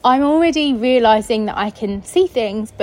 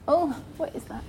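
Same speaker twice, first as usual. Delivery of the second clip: hushed, higher-pitched wary question; real startle; 5 semitones higher, 10 dB quieter than usual. Real, not acted. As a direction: break off, softly spooked. Startled by something while recording.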